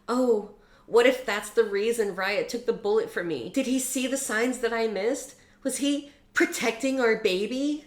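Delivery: a hint of a gushing heroine; mock Blakely. Reviewer mocks Blakely's naive realisation in a high voice. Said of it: "then Blakely is like".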